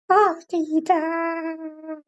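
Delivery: put-on high, very slow childlike "oh no"; a small puppet drawing out its dismay. Puppet dismayed it can't enter heaven. (suara boneka kecewa, tinggi dan lambat)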